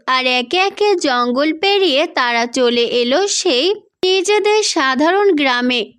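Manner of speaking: usual speaking voice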